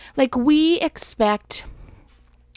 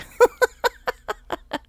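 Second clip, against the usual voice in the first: fond laugh; 12 semitones higher than usual. Real, not acted. Laughs at pizza memory.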